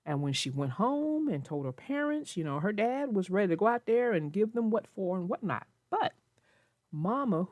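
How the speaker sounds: ordinary delivery, nothing marked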